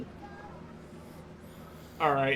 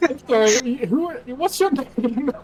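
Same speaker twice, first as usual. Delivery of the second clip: high character voice; 11 semitones higher, 7 dB louder than usual. Acted. Minstrel character speaks.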